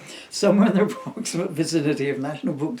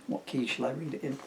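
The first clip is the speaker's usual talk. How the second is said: ordinary delivery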